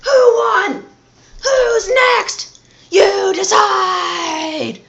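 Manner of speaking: very high, loud, drawn-out announcer cry; hyped announcer. Acted. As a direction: bellow it like a wrestling announcer. Show announcer outro.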